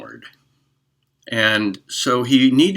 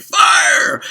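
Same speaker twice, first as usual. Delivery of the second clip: high, loud shout of fire; shout of alarm; 10 dB louder than usual. Acted. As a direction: scream it to empty the theater. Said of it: "So he cried".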